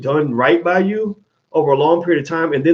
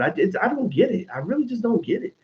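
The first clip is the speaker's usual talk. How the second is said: usual speaking voice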